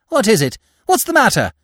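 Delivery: high, alarmed wife's voice; gasped dismay. A narrator's reading. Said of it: "Madame Dougreval gasped in dismay".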